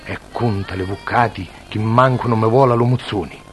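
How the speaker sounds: softly swelling dialect poem recital; theatrical poetry reading. Acted. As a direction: recite it like a stage poem, drawing out each line. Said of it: "recitare una poesia".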